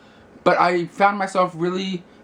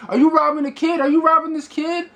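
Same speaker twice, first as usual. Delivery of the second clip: mock outrage, much higher pitch; accusing bystanders; 10 semitones higher than usual. Bystanders confront the man on the street, mock accusing. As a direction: imitate passersby challenging a suspected thief.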